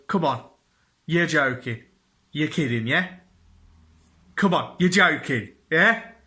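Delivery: a hint of wife refusing to believe it; imitating wife. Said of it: "she, she does this".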